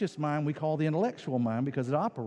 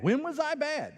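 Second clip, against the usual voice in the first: indignant mock child; 11 semitones higher than usual. Child arguing with parent.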